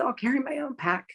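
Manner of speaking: holding back tears as she says it; near tears. Real, not acted. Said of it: "I'm kinda crying about thinking about it".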